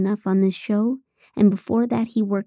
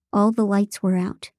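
plain reading voice

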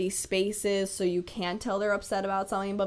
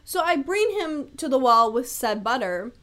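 normal talking voice

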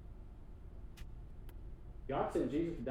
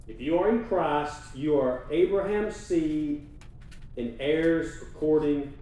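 louder, slow insistent restatement; emphatic repeat; 3 semitones higher, 6 dB louder, slower than usual. Acted. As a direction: drive the promise home, slowly. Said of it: "That's you".

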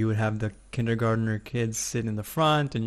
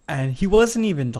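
ordinary delivery

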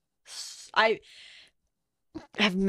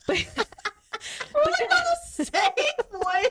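laughing; 13 semitones higher, much slower than usual. Real, not acted. Laughing mid-sentence.

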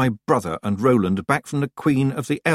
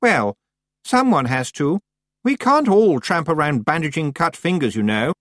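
higher, defensive haughty voice; less confident; 6 semitones higher, 3 dB louder than usual. A narrator's reading. Anagramma defending her teacher to Tiffany, less confident. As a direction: justify it, slightly put out. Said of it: "looked a little less confident".

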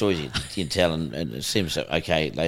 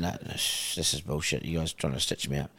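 hushed denial; 4 semitones lower, 9 dB quieter than usual. Retold or imitated. Denying the accusation, retold.

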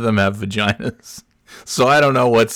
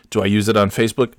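ordinary delivery

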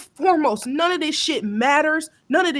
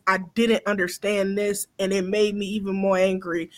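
ordinary talk, nothing marked

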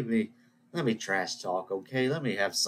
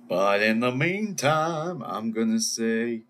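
slow and louder, milking the moment; dramatic slow build; 5 dB louder, slower than usual. Pretend. Signing off the show with a slow, louder mock build. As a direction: drag it out for effect. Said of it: "dramatic build to goodbye".

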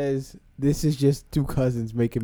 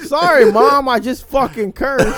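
loud, laughing sorry to mom; laughing mock apology; 10 semitones higher, 12 dB louder than usual. Friends laughing, apologizing to mom for cursing. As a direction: giggle through a fake apology.

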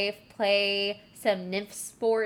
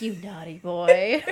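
louder, lightly hammed-up flirty put-down; flirty and condescending; 6 dB louder than usual. Host re-enacting Apollo's taunt to Eros, mock condescending. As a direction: talk down with a hint of flirtation. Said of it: "so flirty, but so condescending".

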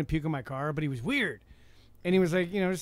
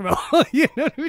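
higher, louder laugh through the words; 7 semitones higher, 7 dB louder than usual. Real, not acted. Driver cracking up at his own Taco Bell story, laughing. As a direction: laugh while telling the punchline.

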